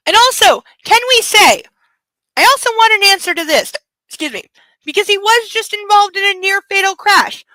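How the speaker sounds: excited high pitch. Real, not acted. Excited question to viewers.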